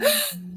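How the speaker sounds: sharp high gasp of real delight; a sudden gasp as the chat lights up. Real, not acted. Host gasping as the chat fills with hashtag love.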